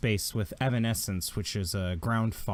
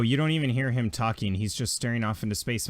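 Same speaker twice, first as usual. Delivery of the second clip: ordinary talk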